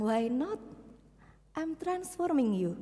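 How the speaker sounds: soft, slow, calm reply; calm answer. Acted. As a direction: answer gently and firmly. God answers gently. (jawaban Tuhan yang lembut dan pelan)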